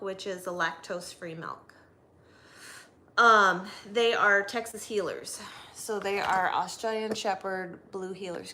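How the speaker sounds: ordinary talk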